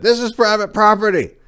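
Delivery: high, loud warning yell from a stranger; farmer's warning. Retold or imitated. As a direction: yell it at the trespasser over the shotgun. Farmer with cocked shotgun.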